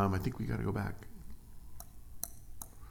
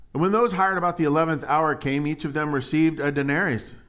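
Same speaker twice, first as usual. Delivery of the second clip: higher, louder reading; scripture reading; 7 semitones higher, 7 dB louder than usual. Acted. Preacher reading the next verse to the church. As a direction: proclaim the verse.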